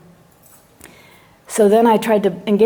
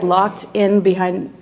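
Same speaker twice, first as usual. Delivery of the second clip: ordinary talk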